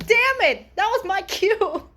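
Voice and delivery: mock frustration. Fan beaten to her cue by someone else, playfully frustrated. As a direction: cry out in mock annoyance.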